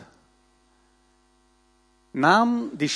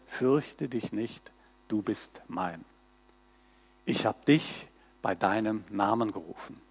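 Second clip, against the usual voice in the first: soft recitation; 8 semitones lower, 9 dB quieter than usual. Shown as a demonstration. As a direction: murmur it gently to yourself. Verse as on a greeting card.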